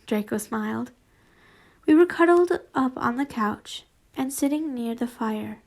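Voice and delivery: ordinary delivery, nothing marked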